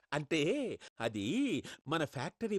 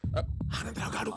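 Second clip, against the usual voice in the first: hushed aside; 4 semitones higher than usual. Acted. Aside while caller waits.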